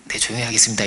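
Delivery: low, subdued obedient reply; acted docile answer to a scolding; meek compliance. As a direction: give in at once, meekly. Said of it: "이랬으면".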